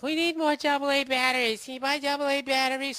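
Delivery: high-pitched mock girlfriend voice; girlfriend imitation. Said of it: "my girl was like".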